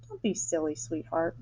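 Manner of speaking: put-on mother gently calming her demanding child, soft; gentle mother. A narrator's reading. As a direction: hush her daughter tenderly. Mother calming her spoiled daughter.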